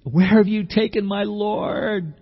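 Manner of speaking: high, slow pleading plea; Mary begging the gardener for her Lord. Pretend. Mary pleading, high and slow.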